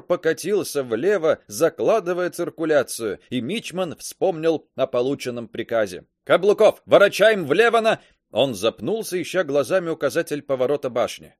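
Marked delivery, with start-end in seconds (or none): 6.2-8.0 shouting order. A narrator's reading. Orders over battle noise.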